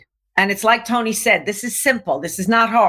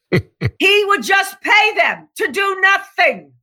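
incredulous shout; 9 semitones higher, slower than usual. Real, not acted. Marveling at the billionaire's idle trainers, incredulous shout. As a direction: exclaim in disbelief.

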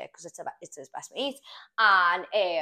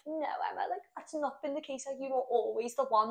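higher, softer imitated pleading; retold reassurance in a raised voice; acted earnest plea; 7 semitones higher, 3 dB quieter than usual. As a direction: play the lover gently protesting. Acts out his earnest reply.

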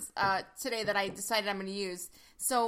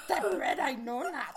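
croaky little sage's voice, breaking into laughter; Yoda; 2 semitones higher than usual. Acted. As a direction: playfully imitate the wise green alien through giggles. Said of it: "answer in a Yoda voice".

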